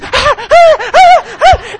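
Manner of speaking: loud, high panting like a happy little dog; imitated yappy dog panting; excited dog panting.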